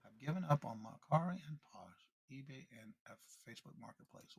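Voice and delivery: very soft, trailing almost to nothing; reading to herself. Real, not acted. Host rechecking a viewer's comment to herself, very quietly. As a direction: reread it under your breath.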